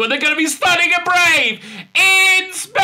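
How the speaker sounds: loud high mock fanfare; mock grand. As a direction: belt the sarcastic punchline. Mocking climax line.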